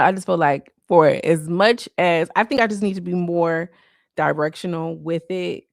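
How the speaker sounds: normal talking voice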